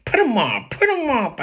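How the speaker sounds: loud, high comic challenge to a fight; Cowardly Lion. A narrator's reading. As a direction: put on a swooping, blustering lion voice. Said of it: "in my best cowardly lion voice".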